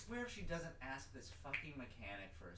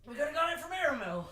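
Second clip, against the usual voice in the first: high, loud exasperated cry; exasperated shout; 12 semitones higher, 11 dB louder than usual. Acted. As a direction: throw up your hands and yell. Exasperated mock outburst.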